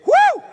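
high, loud whoop of delight; joyful whoop. Real, not acted. Preacher whoops over the conversion, with a loud high whoop. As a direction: let out a happy shout.

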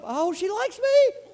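high, slow, hammed-up self-reassurance; mock hopeful. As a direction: fake a hopeful whisper to yourself. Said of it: "pressure on me to go".

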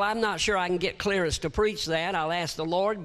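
ordinary delivery

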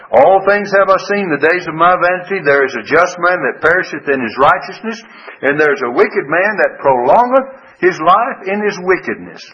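scripture reading. Acted. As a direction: proclaim the verse to the pews. Rereads the same verses.

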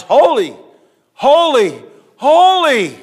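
building shout of holy. Acted. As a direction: climb to a ringing shout on the last holy. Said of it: "to show how important it is".

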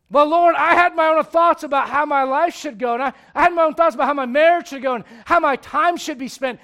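loud, defensive pleading before the Lord; loud pleading excuses. Acted. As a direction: justify yourself loudly, hands up. [name] makes excuses to God.

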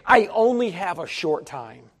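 preacher voicing Jesus for the church, soft and slow; soft solemn quote. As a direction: let it settle slowly and softly. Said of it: "he was saying".

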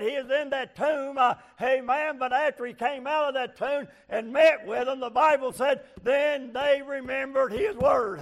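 high, loud revival preaching; full-force preaching. Acted. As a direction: preach it out to the congregation.

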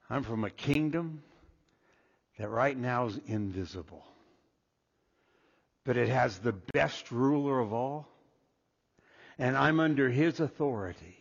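low, hushed, sincere testimony; quiet sincerity. Acted. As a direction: confide it softly and earnestly, pitched low. Believer's acted answer to strangers.

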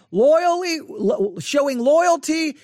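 "loyally" and "loyalty" pitched high; stress on loyalty. Real, not acted. Teacher explaining the dog picture, stressing "loyalty". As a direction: lean hard on "loyally" and "loyalty", raising the pitch. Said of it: "I'm stressing the word loyalty".

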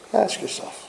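breathy whisper, no voice. Shown as a demonstration. A preacher whispering an urgent appeal to his listeners. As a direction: hush it right down, as if into the listener's ear.

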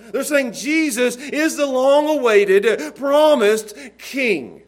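high, loud, slow proclamation; climactic preaching. Real, not acted. Preacher proclaims Jesus the promised king, climactic preaching. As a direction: land each word with weight.

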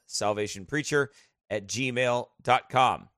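slowly spelled-out address; slow dictation. Shown as a demonstration. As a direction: say it slowly, so they can write it down. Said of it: "Our PayPal address is salvationpreacher".

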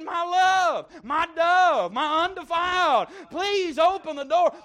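tender plea. Acted. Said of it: "He said".

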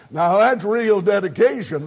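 normal talking voice

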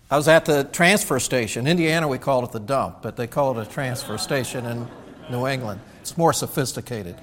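ordinary talk, nothing marked

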